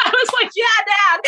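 high, laughing "Yeah, Dad"; laughing cheer. Real, not acted. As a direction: cheer him on through laughter.

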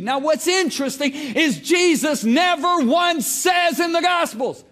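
loud, high, slow sermon shout; full-force preacher voice; shouted preaching. Acted. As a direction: hammer the point home.

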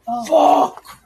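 high, loud cry of dismay; startled cursing. Real, not acted. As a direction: yell out at a sudden mishap. Reacting to spilled soda.

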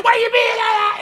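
high mock yell; retold scream. Friend retells screaming at wrong car, high mock yell. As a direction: squeal it like you're cheering. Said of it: "we're screaming".

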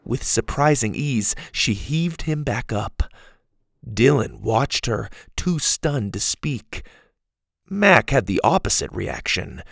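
ordinary delivery, nothing marked

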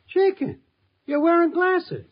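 sudden high surprised outburst. Acted. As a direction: jump up in pitch, caught off guard. Al notices the glasses.

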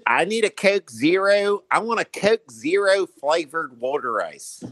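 high-pitched mock Philly accent. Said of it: "with a Philly accent".

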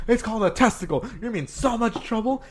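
high, loud imitated scolding; yelling teacher. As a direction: tell off a pupil in outrage. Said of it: "she was yelling at me like".

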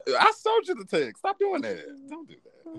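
high playful bickering. Real, not acted.

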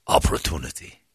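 low, hushed, savoured word; beautiful speaking voice. Retold or imitated. Attendant explaining what brought him here, beautifully spoken. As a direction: imitate a suave attendant's solemn answer. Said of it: "He's got a beautiful speaking voice".

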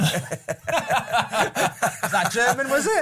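high squeal of laughter while asking; laughing. Real, not acted. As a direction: ask it while cracking up.

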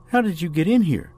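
high-pitched, startled woman's voice; bewildered question. A narrator's reading. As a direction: raise the pitch in disbelief. Jade questions the unexpected visitor.

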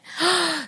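acted sharp, high gasp; shocked gasp. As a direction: re-enact a sudden intake of breath. Re-enacts her shock after hanging up.

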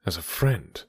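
low, soft, disappointed echo; rueful repeat. Acted. Knightley echoing Emma's words, low rueful repeat. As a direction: turn her word over sadly. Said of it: "repeated Mister Knightley".

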